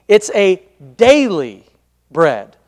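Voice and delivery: "daily" leaned on, then a pause before "bread"; stress on DAILY. Real, not acted. Preacher giving his first point, heavy emphasis on "daily". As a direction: punch "daily" and let "bread" drop after a beat. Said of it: "Emphasis on the word daily".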